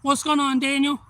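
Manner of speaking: high, loud shout-out to a viewer; real, booming hey-there to someone arriving; loud greeting. Real, not acted. Greets a viewer joining the stream.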